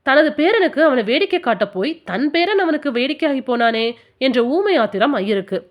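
ordinary delivery

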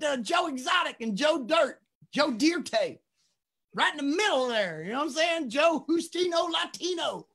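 high, loud comic character voice; joking put-on voice. Joking to the host about having no power, hammed-up high voice. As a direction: push it way up high, hamming it up.

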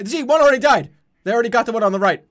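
high, loud, rushed dismay; shocked loud outburst. Real, not acted. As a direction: blurt it out in alarm. Duck just got shot.

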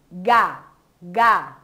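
ordinary talk

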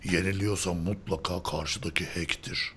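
a hint of a mumbling commenter, low and soft; reading a comment. Host reading a viewer's comment aloud in a low deadpan voice. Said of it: "Biri ne yazmış geçen yorumlarda".

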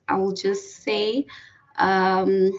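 normal talking voice

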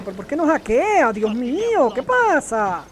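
loud, high exasperated yelling; frustrated outburst. Real, not acted. Gamer whose hacking keeps failing, yelling loud and high in frustration. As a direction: rage at a game that won't cooperate.

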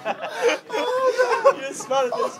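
high squealing laugh through the words; barely getting it out for laughing. Real, not acted. Cracking up reading texts.

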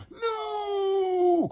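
very high, drawn-out wail; anguished cry. Acted. Edmund watches toys being offered, anguished cry. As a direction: wail it in horror. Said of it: "cried Edmund".

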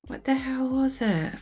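whispered to herself, no voice. Real, not acted. Puzzling over the actor's movie, whispered to herself. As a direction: breathe the question out under your breath.

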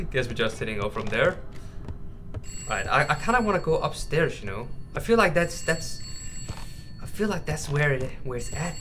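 normal talking voice

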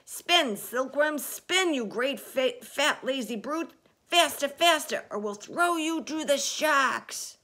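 loud, high, bullying shouted orders; frantic high-pitched shout. A narrator's reading. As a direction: yell orders down at lazy workers.

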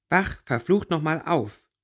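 laut, höher und langsam herausgeschrien; wütender Schrei. Acted. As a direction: schrei ihn an, damit er endlich aufwacht. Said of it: "schrie plötzlich Winona laut". (Winona schreit den bewusstlosen Jungen an, laut und verzweifelt)

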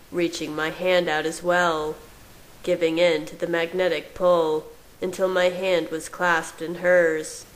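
ordinary delivery, nothing marked

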